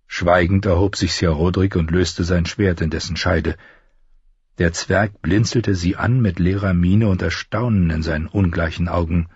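plain reading voice

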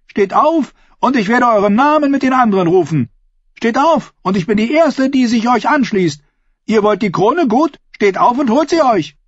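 high, loud, pressing woman's voice; woman's taunting challenge. A narrator's reading. Woman dares Erik before the crowd, high loud taunt. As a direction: goad him to rise and take the crown. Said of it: "rief sie".

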